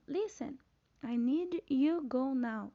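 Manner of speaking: insistent teacher's demo of an imperative; emphatic command. Shown as a demonstration. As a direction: give a weighty, deliberate command with "go now" stretched out. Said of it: "Se vocês perceberem na minha entonação na voz, eu falei com mais".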